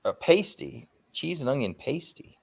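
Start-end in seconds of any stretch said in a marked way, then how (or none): none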